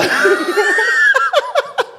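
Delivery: high burst of real laughter; big laugh. Real, not acted. Guest remembering Derek's answer, a big real laugh. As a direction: crack up out loud.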